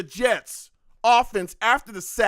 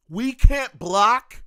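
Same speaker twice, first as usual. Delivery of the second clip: incredulous; 7 semitones higher, 8 dB louder, slower than usual. Real, not acted. Fan watching his team fail.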